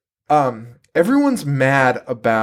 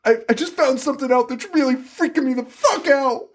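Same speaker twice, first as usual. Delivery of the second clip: high, frantic imitation of a screamer; mock scream; 10 semitones higher than usual. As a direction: keep up her screeching, pitched high. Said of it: "she's still screaming".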